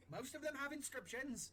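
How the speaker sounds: high, slow, loud shout; exasperated distant yell; shouting up from below. Acted. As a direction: stretch the words so they carry up the mast. Shouting up to the crow's nest.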